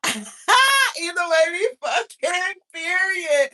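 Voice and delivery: slow, loud, high, drawn-out proclamation; a big drawn-out high call that settles it; emphatic declaration. Acted. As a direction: stretch every word out like a final verdict. Emphatic sign-off.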